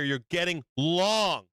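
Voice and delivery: "long" stretched out slowly; drawn-out emphasis. Real, not acted. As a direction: lean on "long" and hold the vowel. Drives home the trading rule.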